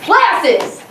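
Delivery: high, loud nickname call. Retold or imitated. Teacher calls the girl up by nickname, loud call. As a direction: shout her out by nickname.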